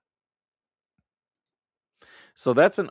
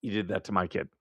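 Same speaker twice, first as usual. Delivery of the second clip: ordinary talk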